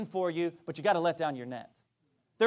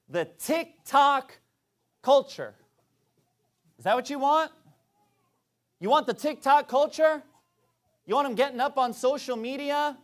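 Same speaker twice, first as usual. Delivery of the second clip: raised, louder scornful preaching; preaching at full force; 6 semitones higher, 5 dB louder than usual. Acted. Preacher scorning TikTok culture to parents, raised and loud. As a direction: thunder it at the congregation.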